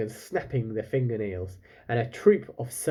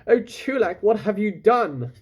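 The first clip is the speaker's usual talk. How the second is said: despairing; 7 semitones higher, 5 dB louder than usual. Acted. Father despairs at Chulak.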